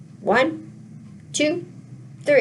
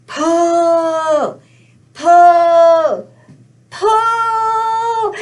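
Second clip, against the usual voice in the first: loud, drawn-out pulls climbing higher; straining heave; 4 semitones higher, 8 dB louder, much slower than usual. Pretend. Storyteller urging everybody to pull the turnip, loud effortful heave. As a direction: bellow each PULL long, rising on the last. Leads audience in final pull.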